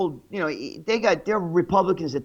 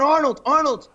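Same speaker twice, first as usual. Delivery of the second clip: higher, louder imitation of a pestering fan; mock eager calling out; 6 semitones higher, 5 dB louder than usual. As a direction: call for the star's attention in mock eagerness. Fan trying to get Arnold's attention.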